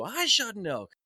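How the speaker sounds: high mock shout; retold scream. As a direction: imitate a friend hollering back. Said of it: "Is just screaming at each other".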